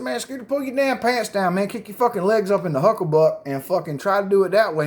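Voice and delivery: high, excited telling; retold own words. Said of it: "So I tell him, I said".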